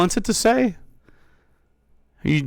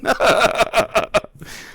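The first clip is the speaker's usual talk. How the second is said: mocking laugh; 5 semitones higher than usual. Real, not acted. Streamer laughing at an angry chatter, mocking laugh.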